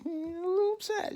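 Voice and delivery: high sarcastic understatement; squeaky mock understatement. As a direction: squeak out a little upset sarcastically.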